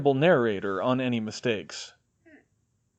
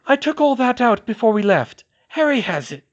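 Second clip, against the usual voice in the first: Hermione voice; 11 semitones higher, 8 dB louder than usual. A narrator's reading.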